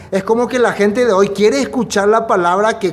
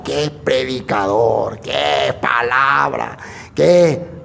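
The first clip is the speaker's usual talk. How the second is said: mock awe; 6 semitones lower, much slower than usual. Mocking admiration of famous preachers.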